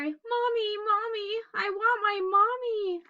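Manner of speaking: high, wailing little bunny calling for mommy; a small lost child crying out; high plaintive wail for mommy. Acted. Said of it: "Bunny started to cry".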